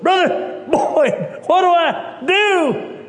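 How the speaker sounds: high, slow, loud cry for help; panicked plea. Retold or imitated. Young preacher calling out to Brother Boyd for help. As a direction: call out helplessly to the older pastor. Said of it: "I turned to Brother Boyd and said".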